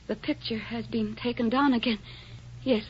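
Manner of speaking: usual speaking voice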